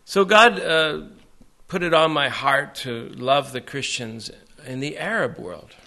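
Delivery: usual speaking voice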